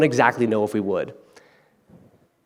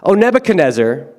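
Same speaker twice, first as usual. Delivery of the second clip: higher, louder defiant Oh Nebuchadnezzar; bold defiance; 5 semitones higher, 6 dB louder than usual. Pretend. The three men defying the king, bold and louder. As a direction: stand up to the king by name.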